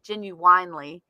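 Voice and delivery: Irish fan's "genuinely" with the middle stretched to "genu-wine-ly"; lightly imitated Irish "genuinely". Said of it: "especially when he says genuinely".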